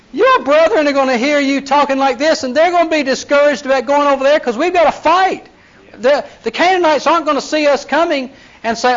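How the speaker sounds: high-pitched exasperated scolding; retold Moses outburst. Moses scolding Reuben and Gad leaders, high exasperated outburst. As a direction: let him blow up at the two tribes. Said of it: "How do you expect Moses to react?".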